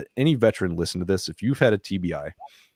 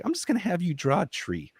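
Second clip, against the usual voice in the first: put-on much higher, softer sweet old lady voice; little old lady; 11 semitones higher, 5 dB quieter than usual. Art therapist asking a veteran to draw, old lady voice. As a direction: coax like a gentle tiny grandma. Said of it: "tiny little adorable old lady sits down".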